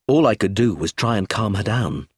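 ordinary delivery

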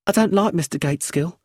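put-on sulky young widow refusing the lawyer; Brenda's voice. A narrator's reading.

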